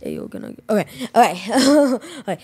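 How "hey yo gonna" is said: deep, low guy voice; really low voice. Pretend. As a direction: drop the voice way down. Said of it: "I'm trying to go really low".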